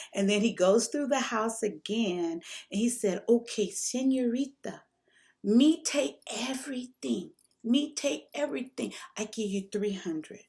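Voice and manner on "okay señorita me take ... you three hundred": imitating a Mexican man's broken English, much higher voice; broken English Mexican accent. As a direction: put on the Mexican guy's accent, high and eager. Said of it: "he's talking to me in his broken English".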